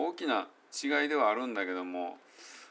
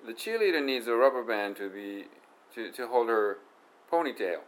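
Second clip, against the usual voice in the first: louder English example; careful teacher read of the cheerleader line; pronunciation demo; 5 dB louder, slower than usual. Shown as a demonstration. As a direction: read it out clearly for the class. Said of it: "こんな感じね".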